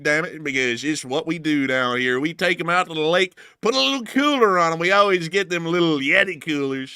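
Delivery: hammed-up Southern good ol' boy rambling. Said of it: "thank you for enjoying this accent".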